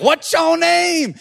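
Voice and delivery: high, drawn-out singsong question; TikTok-style Jesus. Retold or imitated. Jesus questioning the demon, slow high singsong imitation. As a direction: stretch the mock question up high. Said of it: "Jesus is like".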